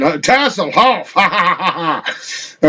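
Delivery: mock German-like Ergothian accent, with a soft drunken laugh. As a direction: play a tipsy foreigner with an Ergothian accent, chuckling. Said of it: "he said in Ergothian".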